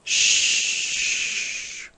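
soft unvoiced hiss of branches; whispered hiss. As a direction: breathe out a long quiet hiss. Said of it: "suttogták a bokrok ágai".

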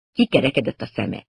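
plain reading voice

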